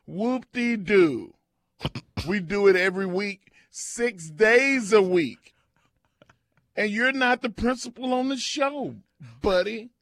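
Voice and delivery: hammed-up angry rant; mock rant. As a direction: rant at callers like a grumpy host. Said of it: "I'm mad".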